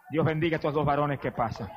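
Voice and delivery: ordinary talk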